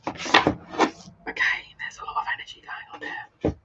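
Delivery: very soft, voiceless aside; whisper. Real, not acted. Tarot reader noting the energy to herself, whispering. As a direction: whisper it as if to yourself. Murmuring while handling the cards.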